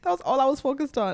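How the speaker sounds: high, gushing thanks; touched and thrilled, voice up high; excited squeal. Real, not acted. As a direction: squeal with delight at the message. Host reacts to sweet message.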